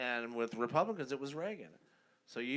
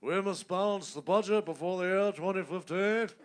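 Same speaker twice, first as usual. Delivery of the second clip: higher, louder mock stiff politician voice; John Kerry impression; 7 semitones higher, 6 dB louder than usual. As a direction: ham up a stiff stump-speech voice. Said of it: "John Kerry was like".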